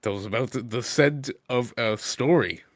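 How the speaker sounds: ordinary talk, nothing marked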